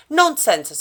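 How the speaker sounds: brisk and no-nonsense, like a kindly neighbour; firm dismissal of the fear. A narrator's reading. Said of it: "said the old lady firmly".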